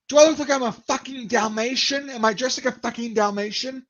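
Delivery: high-pitched, outraged Brit imitation; losing his shit. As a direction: mimic an indignant Brit ranting. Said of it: "he lost his shit".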